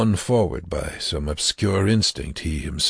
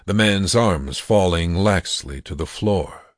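ordinary delivery, nothing marked

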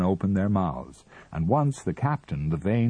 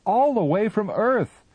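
high and loud; exclaiming; 6 dB louder than usual. A narrator's reading. Captain cuts in excitedly, high and loud. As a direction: cry it out eagerly.

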